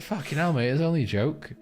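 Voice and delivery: exasperated. Retold or imitated. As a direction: throw up your hands, it was just a joke. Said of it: "I was like".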